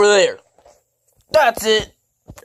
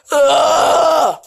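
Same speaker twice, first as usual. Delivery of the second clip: frustrated shouted ugh; 7 semitones higher, 5 dB louder than usual. Acted. Character insulted in quarrel.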